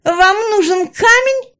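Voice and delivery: very high, loud, slow tease; Раяна, taunting. A narrator's reading. Villainess teases her enemies about the stone, taunting. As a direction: dangle it in a high, drawn-out villainess voice. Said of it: "не унималась Раяна".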